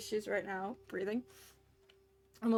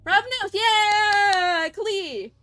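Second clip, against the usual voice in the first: very loud, high, drawn-out cheer; excited cheer; 10 semitones higher, 13 dB louder, much slower than usual. Real, not acted. Streamer cheering at chat, loud drawn-out high cheer. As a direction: stretch it out, thrilled.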